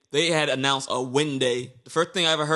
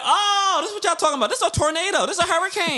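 much higher, half-laughing astonished rant; shocked disbelief. Retold or imitated. Retelling being blown off his feet by the wind, much higher and laughing. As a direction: squeal it out like the wind nearly carried you off. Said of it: "I'm like".

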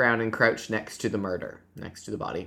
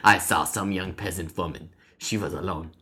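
lower, put-on Russian accent; 3 semitones lower than usual. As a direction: narrate the confession as a Russian. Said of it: "how to do a Russian accent".

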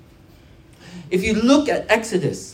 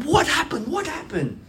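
high-pitched worried question; retold alarm; 9 semitones higher than usual. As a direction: imitate alarmed asking, voice jumping up. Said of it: "I'm scared".

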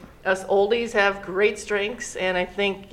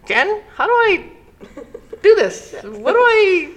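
put-on helpless older person; 11 semitones higher, 6 dB louder than usual. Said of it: "sometimes it's like".

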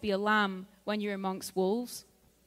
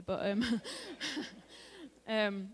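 usual speaking voice

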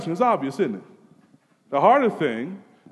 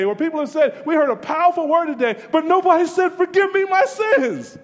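high, loud, driving sermon voice; full-force preaching; 8 semitones higher, 5 dB louder than usual. Acted. Preacher pleading with sinners, full force. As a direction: proclaim it with rising urgency.

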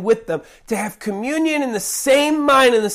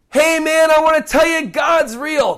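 raised, louder all-caps shout; emphatic yell; 6 semitones higher, 3 dB louder than usual. Shown as a demonstration. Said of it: "that means we're yelling".

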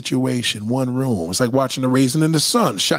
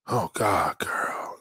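whispered sigh; 5 semitones lower, 8 dB quieter, much slower than usual. Acted. Afterglow whisper.

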